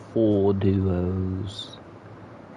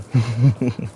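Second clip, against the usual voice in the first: laugh; 4 semitones higher, 5 dB louder than usual. Real, not acted. Laughing after accent bit.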